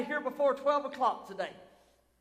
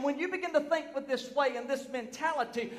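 normal talking voice

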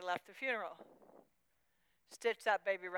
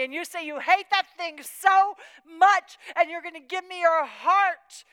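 loud, high, fervent preaching; preaching at full force; 6 semitones higher, 11 dB louder than usual. Acted. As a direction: drive the point home to the congregation.

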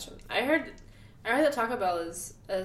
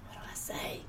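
hushed whispered thought; whispering; 12 dB quieter than usual. Retold or imitated. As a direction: whisper it to yourself in panic. Panicked thought on meeting [name].

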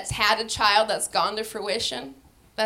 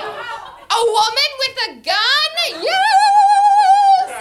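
very high, loud, sung-out joy; ecstatic cheer; 11 dB louder, much slower than usual. Retold or imitated. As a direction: belt the yes like a victory. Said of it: "I'm like".

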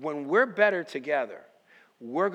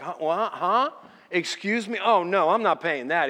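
retold disbelief, higher and louder; indignant mock shock, building louder; incredulous refusal; 3 semitones higher, 7 dB louder than usual. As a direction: balk at it, pitch jumping up. Retold shock at repair price.

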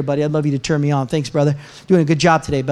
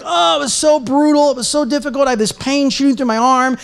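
mock anguished whining; 6 semitones higher than usual. Acted complaint after the climb.

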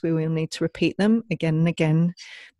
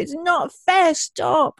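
put-on high, louder child's protest; mock upset child; 12 semitones higher, 6 dB louder, much slower than usual. Mother voicing a child protesting the limit, high and loud. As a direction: cry it out like a kid told no. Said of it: "they might say".

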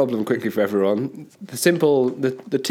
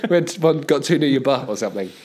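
normal talking voice

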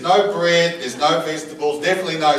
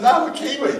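tag insistent; 11 semitones higher, faster than usual. Retold or imitated. Acted denial of being South African.

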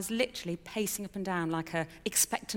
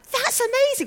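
much higher and louder, delighted squeal; thrilled exclamation; 10 dB louder than usual. Retold or imitated. Wife hearing husband had the same call, thrilled exclamation. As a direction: gush over the coincidence. Reacting to husband's matching news.

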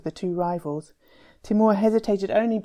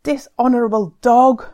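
furious shout; 6 semitones higher, 11 dB louder than usual. Acted. Abdul roaring an insult at the enemy group, furious shout. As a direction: bellow the insult across the square, high and loud. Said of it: "Abdul shouted".

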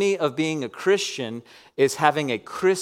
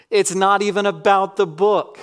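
forceful declaration; 5 semitones higher, 5 dB louder than usual. Acted. Preacher's emphatic declaration.

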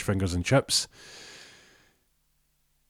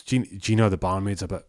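ordinary delivery

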